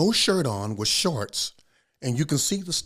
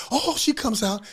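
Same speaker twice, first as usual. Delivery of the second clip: usual speaking voice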